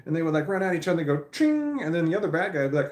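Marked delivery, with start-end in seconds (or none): 1.3-1.8 high, loud mouth sound of clashing swords; sword clash noise. Retold or imitated. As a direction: ring out the blade hit like a cartoon. Mimicking anime sword clash.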